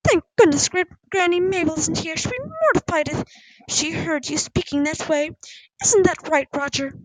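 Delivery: high-pitched, huffy indignation; scandalised. A narrator's reading. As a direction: scold in shock, then demand agreement. Scandalised mother scolds daughter.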